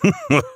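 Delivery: high acted ho-ho laugh; short jolly Santa chuckle; Santa's laugh. A narrator's reading. Santa laughs before ordering fire, short chuckle.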